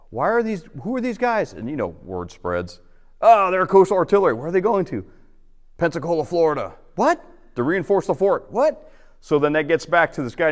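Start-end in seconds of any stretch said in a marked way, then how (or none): 0.1-1.5 high-pitched mock curious question; puzzled onlookers. Onlookers puzzled by soldiers loading boats. 3.2-8.9 high, animated mock back-and-forth rumor; gossiping townsfolk. Acting out gossip spreading among townsfolk.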